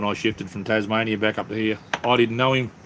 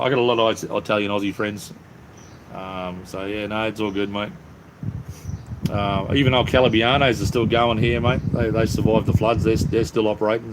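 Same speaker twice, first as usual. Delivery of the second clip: ordinary talk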